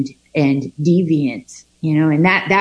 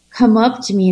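normal talking voice

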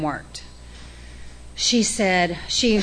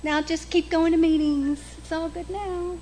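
much higher, airy mock-cheerful voice; breezy sponsor; 10 semitones higher than usual. Sponsor sending Joe off, high breezy reassurance. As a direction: brush it off sweetly. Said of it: "she said".

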